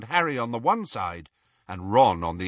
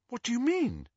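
Hermione confused; 12 semitones higher, 4 dB quieter than usual. Acted.

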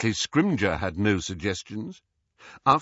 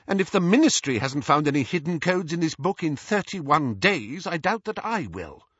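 higher-voiced young witch, upset and defiant; Hermione, tearfully indignant; 7 semitones higher than usual. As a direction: retort while close to tears. Tearful Hermione retorts to Scrimgeour.

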